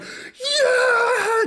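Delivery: very high, full-throated shout of joy; ecstatic yell. Retold or imitated. Friend cheering in the theater, going nuts with a high yell. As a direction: whoop like the crowd is losing it. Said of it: "just going nuts".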